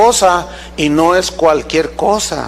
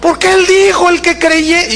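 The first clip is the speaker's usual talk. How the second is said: mock-startled higher voice, hurried; scared young man; 10 semitones higher than usual. Preacher imitates the frightened youth answering the pastor, high mock-fluster. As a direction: play the flustered youth caught out. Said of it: "Y él se asustó y dijo".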